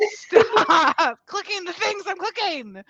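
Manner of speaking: high, laughing "stop"; laughing protest. Real, not acted. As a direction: giggle through the complaint.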